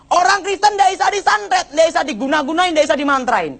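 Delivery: high-pitched imitated preacher's proclamation; Gilbert preaching. Preacher tells church Christians can't be bewitched, high preaching. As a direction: declaim it like a rousing sermon. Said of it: "Tiba-tiba Gilbert khotbah gini".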